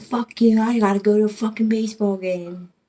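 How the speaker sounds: loud, high cussing; retold angry retort. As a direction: re-enact a heated brush-off in a quarrel. Retold cussing quarrel on the phone.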